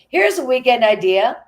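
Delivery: high, excited producer pitch; imitating producer. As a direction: pitch the idea eagerly. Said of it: "he'd be like".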